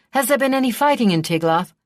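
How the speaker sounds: quick, high and loud, rushing; can't wait for the answer; eager question. A narrator's reading. Eager boy fires an urgent question at the squire, excited.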